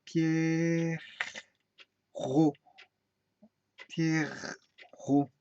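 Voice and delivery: "Pierrot" held, with a gap before ro; syllable breakdown. Shown as a demonstration. Teacher isolating each syllable, "Pierrot" pulled far apart. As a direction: stretch pier, pause, then ro in "Pierrot". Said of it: "la première syllabe".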